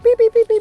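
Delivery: high, loud imitated monitor beeping; machine beeps. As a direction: mimic the alarm going off. Machines start going off.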